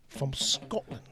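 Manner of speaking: hushed whisper. Real, not acted. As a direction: breathe it out softly. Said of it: "whispered aside".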